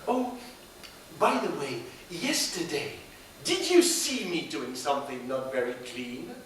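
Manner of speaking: usual speaking voice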